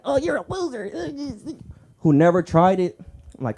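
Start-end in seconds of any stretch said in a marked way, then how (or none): 0.0-0.9 high sneering impression of a hater; mock hater voice. Comic mimics haters, a high sneering voice.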